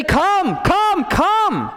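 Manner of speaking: high, strained calling out; obnoxious high-pitched mock shout. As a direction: call the sheep like a pushy impostor. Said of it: "would just shout louder".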